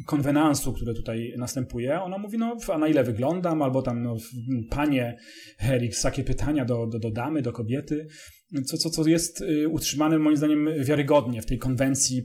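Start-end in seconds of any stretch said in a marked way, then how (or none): none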